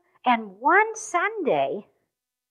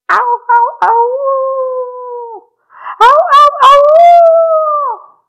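high, loud, long drawn-out howl; animal howl; 13 semitones higher, 14 dB louder, much slower than usual. Retold or imitated. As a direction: howl like a wild animal in the dark. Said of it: "another noise that sounded like this".

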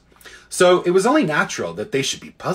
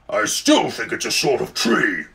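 grumpy warthog voice; stubborn warthog grunt; snorting warthog; 3 semitones lower than usual. A narrator's reading. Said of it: "said the warthog".

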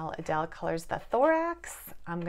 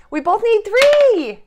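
much higher, much louder and slow; excited exclaim; 13 semitones higher, 15 dB louder, slower than usual. Acted. Parent announcing that both players need a three, loud excited call. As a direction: call it out big for the child.

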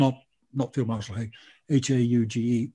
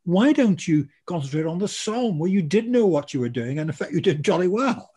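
raised, higher voice scolding the Australians; a raised, impatient lecture to stubborn listeners; exasperated telling-off; 5 semitones higher, 4 dB louder than usual. Retold or imitated. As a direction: give them a loud, fed-up dressing-down. Lecturing Australians about Gallipoli.

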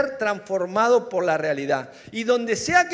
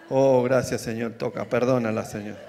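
mock prayer; 11 semitones lower than usual. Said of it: "mock prayer for someone leaving".

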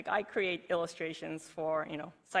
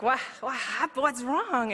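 higher, louder, alarmed questions; retold concern; 7 semitones higher, 4 dB louder than usual. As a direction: ask in worried surprise. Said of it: "And it's like".

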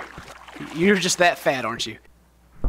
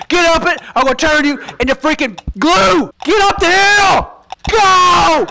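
loud furious shouting at the horse; angry yelling; 4 semitones higher, 6 dB louder than usual. Real, not acted. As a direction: yell in frustration, loud. Yelling at horse Clop uphill.

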